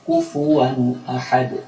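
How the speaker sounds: Kufuwan ahad chanted slowly with a clear n before the a; clear izhar. Shown as a demonstration. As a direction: hold Kufuwan, n distinct, then ahad. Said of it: "Maka diucapkan secara jelas".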